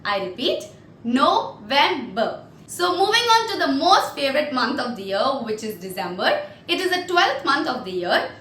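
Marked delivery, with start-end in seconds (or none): 1.0-2.4 NOVEMBER split as No, vem, ber; syllable-by-syllable demo. Shown as a demonstration. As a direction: break "November" into three separate syllables. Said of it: "I'll pronounce it for you".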